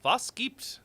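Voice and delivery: put-on German accent. Practising accents by trying out German phrases in a German accent. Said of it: "I don't practice my accents enough".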